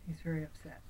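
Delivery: ordinary delivery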